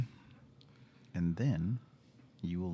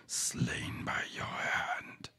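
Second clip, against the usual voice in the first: slow eerie whisper; gravelly whisper; much slower than usual. Acted. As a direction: drag each word out in a hush. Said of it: "a little quiet and a little gravelly".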